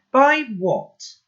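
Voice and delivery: high, loud and cutting; furious shouting. Acted. Boy shouting angrily at calm grandpa. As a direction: hurl every word at him.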